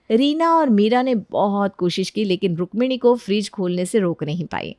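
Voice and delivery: usual speaking voice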